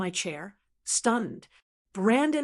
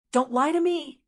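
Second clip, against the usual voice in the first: louder, higher shriek; 6 semitones higher, 4 dB louder than usual. Acted. Son shrieking at his mother on phone, high loud shriek. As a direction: shriek the denial away. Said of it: "he shrieked".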